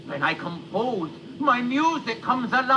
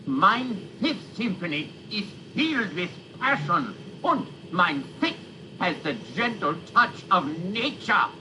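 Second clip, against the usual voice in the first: put-on Beethoven in a light German accent; German-accented composer speech. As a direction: lean into a German accent, mein for my. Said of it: "He's got that German accent on".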